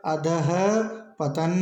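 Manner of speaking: अधः and पतन said as separate parts with a pause; split pronunciation. Shown as a demonstration. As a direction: break अधः पतन apart for the class. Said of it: "शुद्ध शब्द होगा". (अधः और पतन अलग-अलग, बीच में रुककर)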